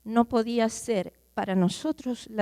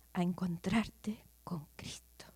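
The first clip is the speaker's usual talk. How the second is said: whisper; 3 semitones lower, 7 dB quieter, slower than usual. Acted. Preacher drops to a whisper.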